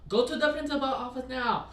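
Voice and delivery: high, loud order in a put-on Chinese accent; teacher ordering student to principal, mock Chinese accent. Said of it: "just imagine that Spanish".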